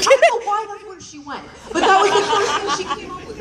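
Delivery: ordinary talk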